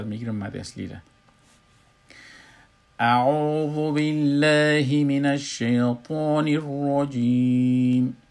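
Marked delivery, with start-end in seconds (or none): 3.0-8.2 slow, high, loud chanted tajweed recitation; Quranic recitation. Shown as a demonstration. Teacher reciting al-Baqarah verses to show students the madd. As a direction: intone each verse slowly with long held madd.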